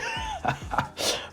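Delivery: high, breathy villain cackle; evil cackle. Acted. Wounded Abaddon cackling at Maui, evil cackle. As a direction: cackle through the pain.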